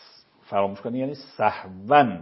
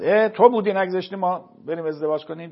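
higher, louder mock blame; accusing complaint; 8 semitones higher, 6 dB louder than usual. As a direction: whine out the blame like a jilted suitor. Acted reproach over failed marriage.

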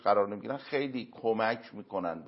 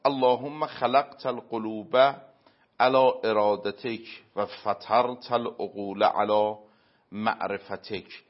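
slow, louder Arabic prayer recitation; reciting a prayer; 6 dB louder, slower than usual. Retold or imitated. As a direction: intone the supplication, drawing out the last word. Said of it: "امام صادق علیه السلام توی دعای".

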